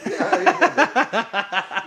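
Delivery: mimicking a friend's garbled words; mock repeat. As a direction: draw out the odd phrase. Repeating the friend's odd phrase.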